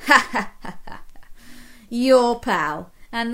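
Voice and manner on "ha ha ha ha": hearty laugh. Acted. Crayon laughs at own joke.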